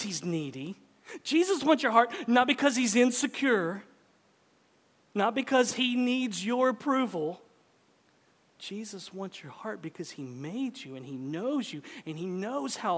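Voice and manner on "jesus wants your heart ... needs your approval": raised, loud insistence; emphatic preaching. Real, not acted. Preacher insisting Jesus is not insecure, emphatic preaching. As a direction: drive it home high and loud.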